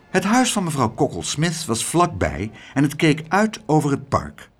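usual speaking voice

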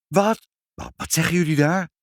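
high, stammered disbelief; startled. Acted. Mother hearing the cats' news, startled. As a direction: blurt it out fast in shock.

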